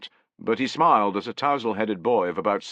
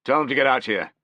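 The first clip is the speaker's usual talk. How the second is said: curt, louder command; snapped order; 6 semitones higher, 5 dB louder, faster than usual. A narrator's reading. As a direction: bark it at the old man. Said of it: "Piso snapped".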